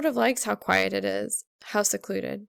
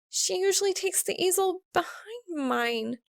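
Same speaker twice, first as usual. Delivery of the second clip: a hint of flustered explaining; nervous girl; 10 semitones higher than usual. A narrator's reading.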